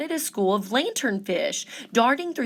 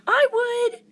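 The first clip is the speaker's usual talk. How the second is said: creaky coral; 5 dB louder than usual. A narrator's reading. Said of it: "creaked a crusty coral".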